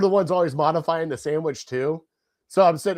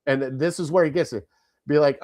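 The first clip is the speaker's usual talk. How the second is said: ordinary talk, nothing marked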